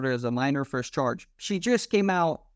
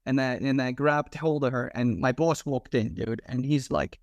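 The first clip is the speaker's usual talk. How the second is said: ordinary talk, nothing marked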